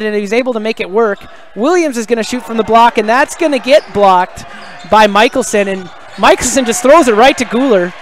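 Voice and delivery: animated basketball commentary; live play-by-play. Real, not acted. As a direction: call the fast break with lifted energy, punching key words.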